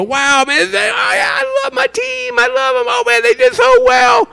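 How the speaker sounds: very high, shouting cheer with a cry in it; ecstatic fan. Retold or imitated. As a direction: scream for your winning team. Fan cheering team win.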